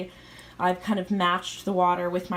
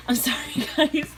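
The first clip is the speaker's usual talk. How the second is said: laughing; 5 semitones higher, 4 dB louder than usual. Real, not acted. Laughs at own stumble.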